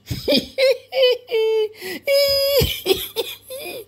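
very high squealing laugh; shrieking giggle. Acted. The three friends laughing at Marilou, shrieking laugh. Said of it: "elles ont ri".

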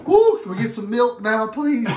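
high and loud, fast plea; whoop, then beg for milk; excited. Real, not acted. Relief after spicy challenge.

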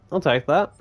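usual speaking voice

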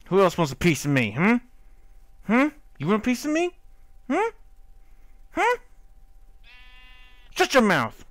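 loud, high taunting challenge; hammed-up fighting shout; mock tough shout. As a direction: taunt the monsters like a brawler.

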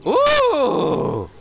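excited. Real, not acted. Big game moment.

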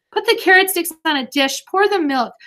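high, quick bossy orders; urgent orders. A narrator's reading. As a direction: rattle off orders. Rapid kitchen orders.

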